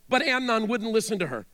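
usual speaking voice

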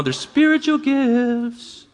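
high, drawn-out spooky voice, trailing off; slow, ghostly mock-ethereal delivery; mock mystical voice. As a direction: send it up as eerie and otherworldly. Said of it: "they're ethereal or mystical".

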